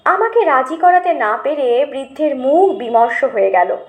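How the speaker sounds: plain reading voice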